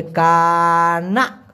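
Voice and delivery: কানা with a long level kaa, then rising naa; drawn-out pronunciation. Shown as a demonstration. Teacher shows how কানা is pronounced, slow and stretched. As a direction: hold the first syllable of কানা. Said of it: "উচ্চারণটা হবে".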